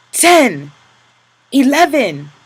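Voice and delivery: loud high count; lash count. Acted. Capo counting lashes, loud and high. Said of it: "His voice was calm".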